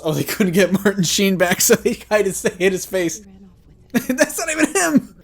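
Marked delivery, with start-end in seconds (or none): 3.6-5.2 laughing. Real, not acted.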